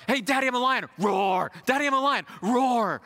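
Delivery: put-on excited small child roaring; little boy playing lion. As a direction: imitate a toddler announcing he's a lion. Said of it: "he'll go".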